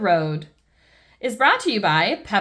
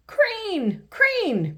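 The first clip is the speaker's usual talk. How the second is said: put-on high, excited toddler shout; thrilled to see the crane; excited cry; 12 semitones higher than usual. George spotting the crane, high and excited. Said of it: "cries George".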